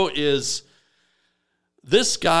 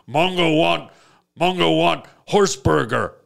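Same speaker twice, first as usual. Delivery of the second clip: blunt caveman craving; a simple brute wanting food; caveman talk. Retold or imitated. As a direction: caveman Mongo demanding a horse burger. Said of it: "he still talks like this".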